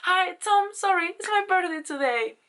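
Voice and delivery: high, breathless fan greeting; excited fan. Retold or imitated. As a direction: blurt it out high and eager. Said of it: "It was like".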